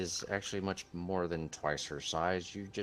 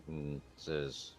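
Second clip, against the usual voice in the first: usual speaking voice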